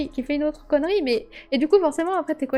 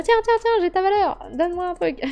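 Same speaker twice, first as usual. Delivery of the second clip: pleading player; 6 semitones higher than usual. Retold or imitated. A player to a teammate, wheedling for a card, high and coaxing. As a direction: coax a teammate into swapping cards.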